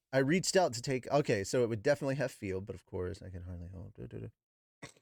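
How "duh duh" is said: usual speaking voice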